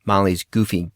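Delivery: ordinary delivery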